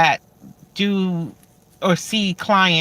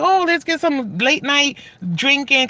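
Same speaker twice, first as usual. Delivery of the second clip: party eager; 7 semitones higher than usual. Retold or imitated. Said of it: "so many nights I'm like".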